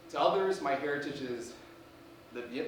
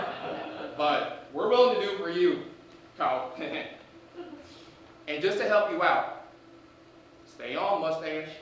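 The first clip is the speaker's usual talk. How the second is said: hammed-up Southern principal; 2 semitones higher, 8 dB louder than usual. Principal character held through sketch.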